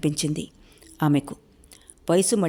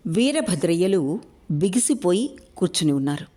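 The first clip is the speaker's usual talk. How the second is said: usual speaking voice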